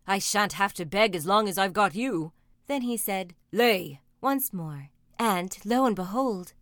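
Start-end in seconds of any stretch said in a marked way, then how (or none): 0.0-2.3 big, gloating giant voice; a touch of amused ogre, raised voice; pleased ogre. A narrator's reading. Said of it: "laughed the ogre". 3.5-4.0 loud one-word command; blunt giant's command; commanding ogre. A narrator's reading. Ogre commands the hen again.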